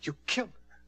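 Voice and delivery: high stunned question; shocked. Acted. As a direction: gasp it in disbelief. Visitor realizes the truth.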